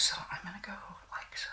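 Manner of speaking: fast, breathy whisper to herself; quick whisper. Real, not acted. Doing her nose, whispering quickly to herself. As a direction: rush it out under her breath.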